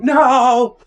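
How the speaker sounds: high, loud, desperate No; frantic high shout, busted; panicked shouted denial. Retold or imitated. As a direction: yell the denial through the door. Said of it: "I'm like".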